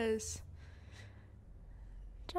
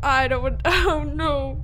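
loud nervous laughing oh no; 3 semitones higher, 7 dB louder, slower than usual. Real, not acted. Player dreads ripping the arm off, loud nervous laugh.

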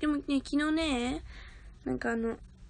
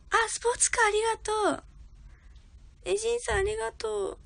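excited thanks; 9 semitones higher, faster than usual. Real, not acted. Thanking gift senders excitedly.

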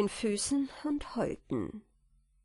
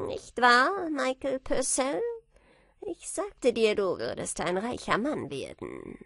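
higher, drawn-in little man's character voice; little man calling; 4 semitones higher than usual. A narrator's reading. Little man hailing Michael on the hilltop, calling out. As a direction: call out in a high put-on voice. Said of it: "von der wohlbekannten Stimme ... die ihm zurief".

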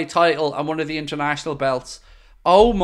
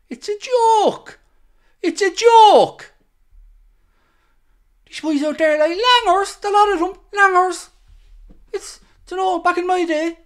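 Roy Keane rant; 13 semitones higher, 4 dB louder than usual. Retold or imitated. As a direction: ham up a ranting old pundit. Mocking Roy Keane ranting.

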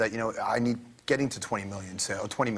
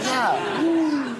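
laughing yeah; 13 semitones higher, 6 dB louder than usual. Real, not acted. Laughs as she pushes back.

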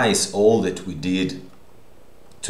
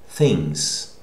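ordinary delivery

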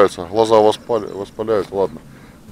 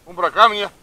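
calling the dog; 8 semitones higher, 4 dB louder than usual. Real, not acted.

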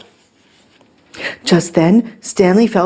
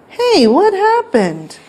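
much higher, slower lady's voice; surprised question; 12 semitones higher, slower than usual. A narrator's reading. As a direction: draw out an astonished question. Lady arrives home.

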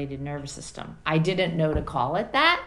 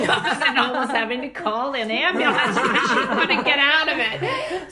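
high, loud voice breaking up with laughter; laughing; 9 semitones higher, 6 dB louder than usual. Real, not acted. Recalling mother stuck in lotus pose, laughing. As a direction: laugh helplessly through the words.

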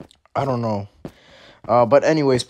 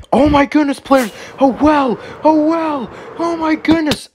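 very high, excited squealing; hammed-up astonished cries; thrilled discovery; 3 dB louder than usual. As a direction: shriek with over-the-top delight. Suddenly spots a well.

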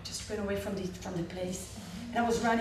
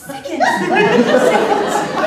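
laughing; 11 semitones higher, 16 dB louder, slower than usual. Real, not acted. Laughing at her own fantasy.

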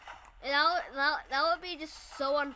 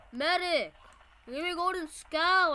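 louder, slower demand for the medic; man down shout; 4 semitones higher, 6 dB louder, slower than usual. Real, not acted. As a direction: yell for the medic again. Calling teammate again.

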